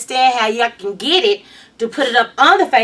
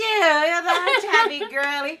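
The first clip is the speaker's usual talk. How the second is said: usual speaking voice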